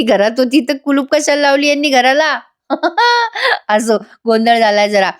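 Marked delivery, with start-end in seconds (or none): 2.7-3.6 quick real laugh, high; brief amused laugh; short laugh. Real, not acted. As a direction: burst out laughing at the absurdity. Narrator laughs at plot hole.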